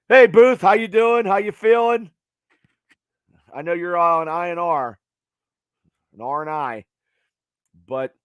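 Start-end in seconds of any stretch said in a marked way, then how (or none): 0.1-2.1 excited greeting. Real, not acted. Greeting a viewer joining live.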